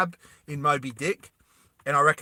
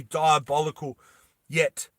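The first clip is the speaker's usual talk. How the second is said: usual speaking voice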